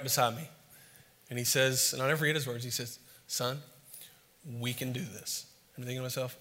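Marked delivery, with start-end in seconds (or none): 3.3-5.5 softer, calm and tender; steady him quietly, like a father; gentle reassurance. Retold or imitated. Dad kneeling beside his struggling son, gentle.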